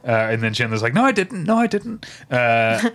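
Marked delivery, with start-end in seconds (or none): 1.0-2.0 high-pitched mock protest; imitating a flustered, high denial; acted hasty denial. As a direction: deny it twice in a raised, pitched-up voice. Retelling Chandler's panicked denial of love.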